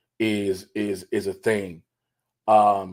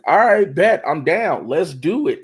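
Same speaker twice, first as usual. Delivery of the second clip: eager agreeing; 7 semitones higher, 8 dB louder, slower than usual. Retold or imitated. Acted eager agreeing quote.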